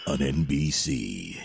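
deep, slow trailer announcer; slow booming network promo read; drama promo voice. Shown as a demonstration. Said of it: "That voice?".

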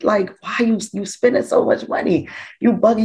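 a high, incredulous telling-off; mock scolding. Mother scolding son about the party costs, high mock telling-off. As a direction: scold your son for overspending, half-joking. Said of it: "I cursed him out every day".

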